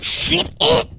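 shrill-high hammed-up shut up; mock squeaky hush. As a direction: snap it way up high in mock exasperation. Sharp hush at friend.

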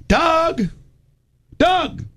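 high call across the yard. Retold or imitated. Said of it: "they can yell back and forth".

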